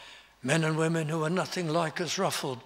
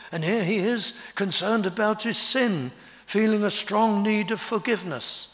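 rising, louder preaching voice; fervent preaching; 4 semitones higher, 4 dB louder than usual. Acted. Preacher urging the congregation, fervent and louder. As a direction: build the sermon to an urgent peak. Preaching builds to full force.

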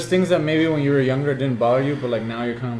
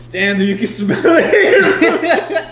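high, slow words through laughter; cracking up mid-quote; laughing; 11 semitones higher, 5 dB louder, much slower than usual. Retold or imitated. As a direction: squeeze it out between laughs. Retold voice, laughing.

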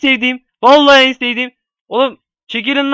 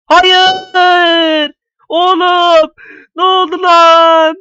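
high, loud stunned cry; shocked shout; 7 semitones higher, 6 dB louder, much slower than usual. Real, not acted. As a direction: yell in disbelief. Knocked out of the game.

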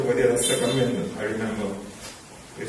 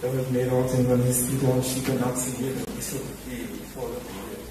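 scripture recitation. Acted. Mourner reciting a psalm for his late teacher, measured. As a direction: recite it like a Sunday school lesson.

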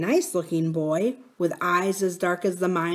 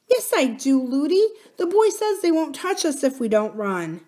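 put-on high, earnest child's voice; small girl insisting to her nurse; little princess; 8 semitones higher, 3 dB louder than usual. Little princess reassuring her nurse, high and earnest.

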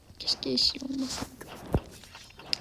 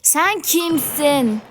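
loud, higher, slow who are you; loud drawn-out challenge; 6 semitones higher, 10 dB louder, much slower than usual. Real, not acted. Gamer confronting an unknown player, loud and slow. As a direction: draw it out to challenge him.

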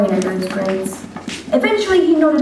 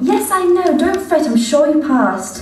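higher, sing-song mother calling her son; doting mother voice; 7 semitones higher than usual. Acted. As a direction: coo at your grown son like a fond mother. Mother voice in told story.

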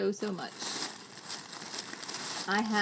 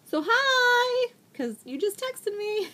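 high, sing-out hello; excited greeting; 12 semitones higher, 4 dB louder than usual. Real, not acted. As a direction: squeal a playful hello to a friend. Greets friend who just texted her.

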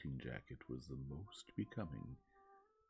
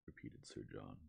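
plain reading voice

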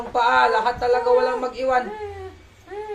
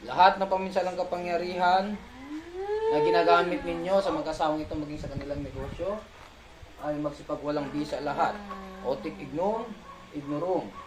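low, measured ritual command; incantation; 4 semitones lower, 5 dB quieter than usual. Real, not acted. Healer revokes the sorcerers' powers, low incantation. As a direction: recite a spell in a lowered, softer voice.

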